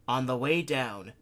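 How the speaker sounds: ordinary delivery, nothing marked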